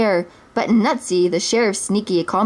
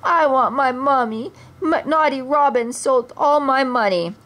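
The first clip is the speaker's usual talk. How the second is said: put-on higher, whining baby voice; whiny crybaby prince; 5 semitones higher than usual. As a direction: whimper for your mummy. Puppet prince mocked as crybaby.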